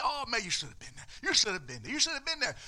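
fast, high, repeated gushing; excited insisting. Retold or imitated. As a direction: press it on him, thrilled. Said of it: "He said".

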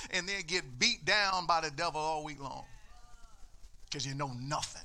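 ordinary talk, nothing marked

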